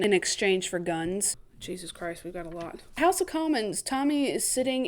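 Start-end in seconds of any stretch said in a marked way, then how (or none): 1.6-2.8 much softer, lower aside; muttered aside. Real, not acted. Viewer sighing at the plot, soft aside. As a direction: say it under your breath.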